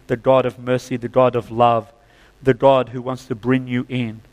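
normal talking voice

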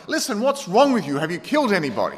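mock exasperated barista, high and pushed; irritated. Barista pressing the pastor, half-mock irritation. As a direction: sound fed up and incredulous. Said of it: "he seemed irritated".

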